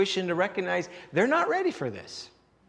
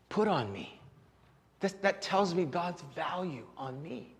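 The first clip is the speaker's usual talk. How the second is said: normal talking voice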